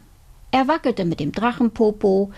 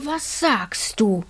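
beaming; slower than usual. Acted.

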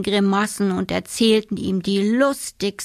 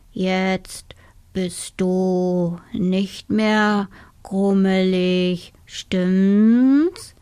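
slow whispered snail; whispering snail; much slower than usual. Acted. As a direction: breathe the words out slowly. The snail asks the dormouse. (flüsternde Schnecke)

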